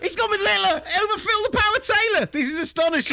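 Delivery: high, loud, far, indignant commentator cry; mock outrage. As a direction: play it up like a scandalised pundit. Mock outrage at darts pick.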